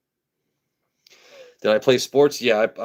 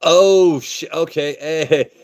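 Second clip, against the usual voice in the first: surprised; 10 semitones higher, 6 dB louder, much slower than usual. Real, not acted. Startled after long pause.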